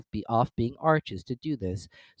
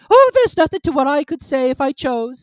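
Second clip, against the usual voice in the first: high, loud self-satisfied duchess; preen, pleased with herself; 12 dB louder than usual. A narrator's reading. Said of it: "in a pleased tone".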